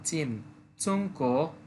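চংকো said slowly with a rising end; চংকো: first tone, then second tone, drawn out; slow tone demo. Shown as a demonstration. Teacher drills চংকো slowly for learners. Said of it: "প্রথমটা first tone".

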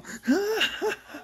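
very high eerie giggle; mad high laugh. Acted. Psycho laughing high and mad. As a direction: cackle like a crazed child.

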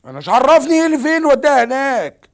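loud, frustrated protest; exasperated outburst. Real, not acted. Man phoning a lost girl's mother, exasperated. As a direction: raise the voice in exasperation.

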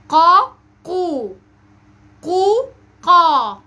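each syllable held and pushed out from the back of the tongue; qaf syllable drill. Shown as a demonstration. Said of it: "دي المقاطع الصوتية".

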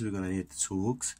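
ordinary talk, nothing marked

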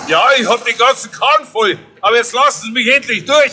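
high, pushy, wants to be waved through; impatient and hurried. Acted. Wanninger urging the officer Bichler to let him pass, impatient. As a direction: press past him, impatient.

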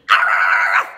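low, rolling angry arf; big gruff puppy bark; loud dog bark. Acted. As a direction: bark loudly like a mad dog. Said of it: "She barks loudly".

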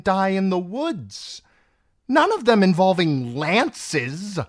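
high, loud, haughty reproach; exasperated scolding. A narrator's reading. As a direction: tick off the options with mounting irritation. Queen scolds Felice over botched plot.

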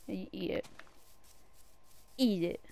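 usual speaking voice

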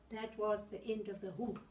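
plain reading voice

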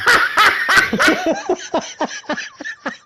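long, high, helpless laughter; high laughing fit. Real, not acted. Cracking up at her own baguette joke, helpless high laughter. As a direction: lose it in a long high cackle.

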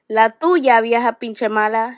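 loud Spanish retort in narration; shouted insult. A narrator's reading. Ramon answers the teacher in Spanish, shouting. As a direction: shout the insult back defiantly. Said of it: "shouted Ramon".

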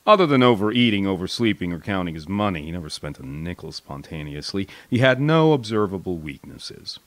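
usual speaking voice

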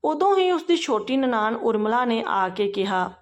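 ordinary delivery, nothing marked

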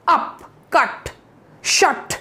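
अप, कट, शट with a quick uh; अप, कट, शट as separate sharp syllables; short pulled UH. Shown as a demonstration. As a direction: cut अप, कट, शट off short on the final stop. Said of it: "खींच के जब आवाज़ लगाते हैं".

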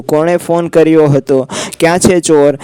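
usual speaking voice

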